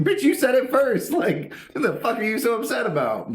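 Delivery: very high, loud laughing shout; hammed-up incredulous yell; retold yell. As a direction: shout them down, half laughing. Narrator yelling at drunk coworkers.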